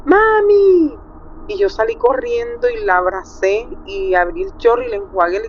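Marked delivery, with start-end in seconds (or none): 0.0-1.0 high, loud cry for mom; girl's cry of mami, much higher and louder; retold scream. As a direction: scream for your mother as you faint. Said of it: "ella gritó".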